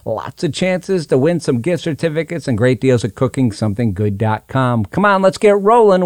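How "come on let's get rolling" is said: excited. Real, not acted. Host hyping the show.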